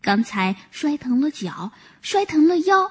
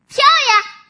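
excited shout; 13 semitones higher, 5 dB louder than usual. Acted. Monkey urges deer to jump.

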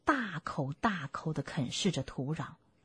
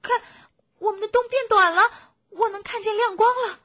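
high, loud excited cub voice; little fox; 13 semitones higher, 8 dB louder, faster than usual. Acted. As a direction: pipe up urgently as a small fox spotting something. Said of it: "其中的一个小狐狸说". (小狐狸又高又响的童声)

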